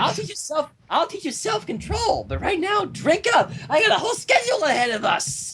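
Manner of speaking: high, perky pony pushing a drink; gleeful pony with big plans; bossy bubbly Pinkie Pie. Acted. Pinkie Pie voiced in story.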